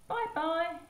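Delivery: put-on high childlike farewell; sweet goodbye. Saying goodbye to the new friend, high childlike call. Visitor/baby waving goodbye.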